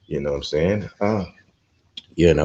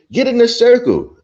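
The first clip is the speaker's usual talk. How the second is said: high, loud shouted command; mock old man order; 7 dB louder than usual. Mocking the old man's order.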